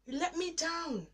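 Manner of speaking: high, loud, pleading cry; anguished lament. Retold or imitated. Alone in the bathroom, crying out to God in anguish. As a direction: pour out hurt and blame at God. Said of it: "And I'm like".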